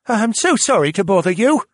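put-on high-pitched young crewman voice; apologetic young sailor. A narrator's reading. Young sailor waking the passengers, apologetic and high. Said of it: "the young sailor began".

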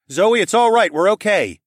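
high, loud calming shout; urgent reassurance. A narrator's reading. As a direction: call out quickly to hold off an attack. Calling to calm armed wife.

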